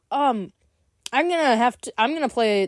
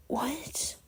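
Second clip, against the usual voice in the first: stunned whisper; 7 semitones higher, 16 dB quieter than usual. Real, not acted. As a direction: breathe it in disbelief. Stunned reaction.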